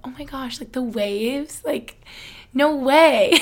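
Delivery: teasing, sarcastic fake amazement; sarcastic echo of a friend's awe, ending loud and high; mock sarcasm. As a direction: poke fun at her gushing. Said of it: "just poking fun at her".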